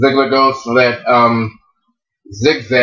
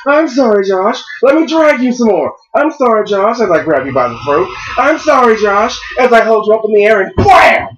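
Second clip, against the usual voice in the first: rising higher with each sorry, gleefully sarcastic; mock Kane apology; 9 semitones higher than usual. Mocking Kane while beating.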